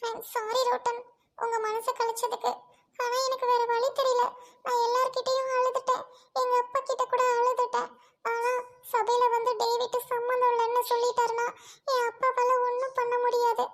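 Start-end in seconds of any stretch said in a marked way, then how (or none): none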